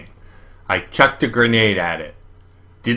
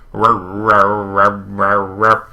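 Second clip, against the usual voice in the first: choked, sputtering mock roars like an engine failing; sputtering lawnmower roar; slower than usual. A narrator's reading. Glitching monster tries to roar at the boys, sputtering mock roar. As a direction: make the roar cough like a lawnmower that won't start. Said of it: "It sounded like a lawnmower trying to start".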